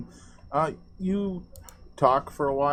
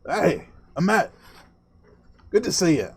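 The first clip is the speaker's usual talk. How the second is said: raised, louder hearty greeting to a friend; friendly Rish greeting; 6 semitones higher, 4 dB louder than usual. Acted. Rish greets Amet mid arm-wrestle, hearty and raised. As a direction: call out cheerfully, a touch higher. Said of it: "Reach goes".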